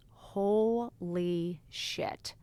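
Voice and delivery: slow, drawn-out holy shit; a stunned, dragged-out realisation. Retold or imitated. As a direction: let it sink in slowly. Stunned by therapist's verdict.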